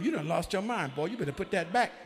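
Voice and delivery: loud, punchy telling-off; loud mock reprimand from kinfolk; scolding. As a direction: scold a foolish boy hard. Scolding Achan as his relations would.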